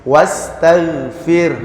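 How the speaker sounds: WASTAGHFIR slowly, vowelless R trailing into an H-like breath; sukun ending. Shown as a demonstration. Teacher showing the pause form, breathy sukun ending. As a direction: draw out WASTAGHFIR and end R on a puff. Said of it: "ada he-nya gitu ya".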